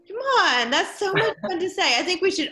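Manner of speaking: high, loud playful coaxing; gleeful coaxing. Real, not acted. Teacher coaxing students to say a phrase, high and gleeful. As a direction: sell it like the best fun ever. Teacher urging class, higher again.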